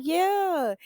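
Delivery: high, flat-out mock agreement; mock auntie yes. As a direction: own it like the auntie. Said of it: "And she like".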